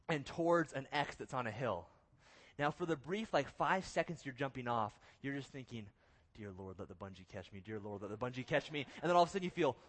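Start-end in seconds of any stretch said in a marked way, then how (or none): 6.3-8.9 hushed, low repeated plea; muttered mock prayer; retold desperate prayer. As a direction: pray under your breath, low and soft. Retold panicked prayer while falling.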